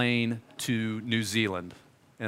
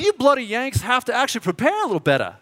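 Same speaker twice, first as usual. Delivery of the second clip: quick, higher, teasing Australian scolding; Australian accent; 9 semitones higher, faster than usual. Retold or imitated. The Kiwi guy scolding the unprepared Yanks, in a mock Australian accent. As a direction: rib the Yanks in a light Aussie accent. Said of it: "impressed with my Australian accent".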